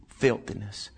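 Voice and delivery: normal talking voice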